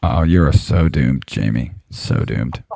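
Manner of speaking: low, slow ominous doom voice; slow ominous raspy drawl. Pretend. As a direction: drag out a creepy warning. Teasing doom warning to Jamie.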